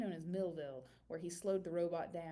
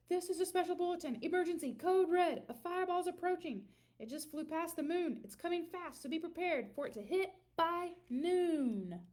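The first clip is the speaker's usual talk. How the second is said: urgent, fast, higher breaking-news voice; news announcer bulletin; 8 semitones higher, 4 dB louder than usual. A narrator's reading. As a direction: announce the emergency like a TV newsreader. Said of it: "the news announcer said".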